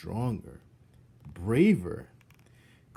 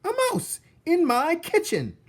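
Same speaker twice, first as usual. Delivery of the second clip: very high, loud shocked cook; 10 dB louder than usual. Acted. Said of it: "said Cook".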